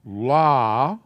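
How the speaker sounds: "la" with an open ah vowel, set apart; teacher demo syllable. Shown as a demonstration. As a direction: model "la" clearly for the class. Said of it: "patak is pronounced".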